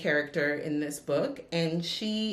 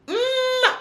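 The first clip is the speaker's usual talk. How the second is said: ordinary delivery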